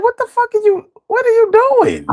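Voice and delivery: high squeaky disbelief; high pitched voice. Retold or imitated. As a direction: squeal like you can't believe them. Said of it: "use the high pitched voice".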